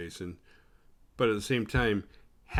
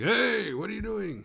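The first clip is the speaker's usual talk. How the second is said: calling out; 12 semitones higher, 3 dB louder than usual. Retold or imitated. Nick calling out to Tina.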